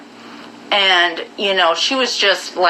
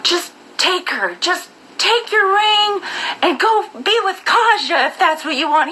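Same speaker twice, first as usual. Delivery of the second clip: mocking the girlfriend; 9 semitones higher than usual. Imitating the jealous girlfriend telling him to go be with Kaja. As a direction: drag it out, higher, as a petty jab. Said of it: "she's like".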